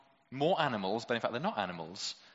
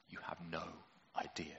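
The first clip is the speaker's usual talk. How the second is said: low and much softer, ominous film warning; low movie voice; 7 semitones lower, 13 dB quieter than usual. Pretend. Preacher warning Job about the monsters, in a low hushed voice. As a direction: drop it down like a movie trailer threat. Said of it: "someone says in a low voice".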